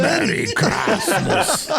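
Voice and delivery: slow, high, laughing Austrian Terminator voice; hammed-up Austrian Terminator growl through laughter; mock Austrian cyborg, slow and laughing; Terminator accent. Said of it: "borderline Terminator".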